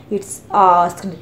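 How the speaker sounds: usual speaking voice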